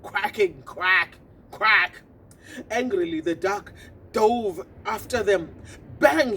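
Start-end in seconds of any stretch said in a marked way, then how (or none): 0.0-2.0 quacking. Acted. Duck sound effect. 6.0-6.4 loud bang. A narrator's reading. Sound effect word.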